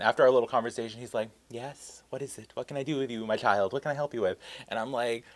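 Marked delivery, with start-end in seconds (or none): 1.5-4.4 very soft, serene guru imitation; hushed, all-patient mock serenity; zen. Suddenly zen director receiving his assistant like a child, serene. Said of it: "zen all of a sudden".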